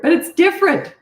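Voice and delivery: high, loud, emphatic insistence on different; pleading loudly with Mom that it's different; insisting. Retold or imitated.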